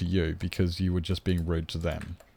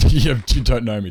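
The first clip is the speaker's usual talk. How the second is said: louder mock retort; 3 semitones higher, 8 dB louder than usual. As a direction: punch it out, louder, with a teasing fall. Repeats the line, punched louder.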